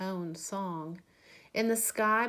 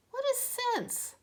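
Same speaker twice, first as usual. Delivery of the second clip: much higher, small curious voice; little bat voice. Acted. Little bat asking his mother, high and curious. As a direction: squeak it like a tiny bat.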